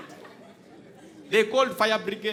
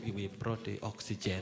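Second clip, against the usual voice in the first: low sheepish officer; 13 semitones lower, 13 dB quieter than usual. Retold or imitated. Officer voiced in retold story.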